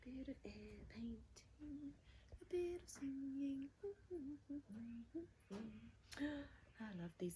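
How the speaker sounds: soft unvoiced whisper to herself. Real, not acted. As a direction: whisper it while busy painting. Painting alone, whispering to herself.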